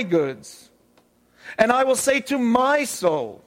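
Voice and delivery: slow reading stressing I and my; emphatic reading. Shown as a demonstration. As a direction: lean on every I and my, slowly. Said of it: "I'm gonna read it with emphasis in a different way".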